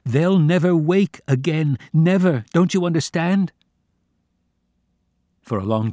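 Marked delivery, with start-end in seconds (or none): 0.0-3.5 yelling against the wind. Acted. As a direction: shout over the icy wind at father. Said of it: "I yelled against the wind".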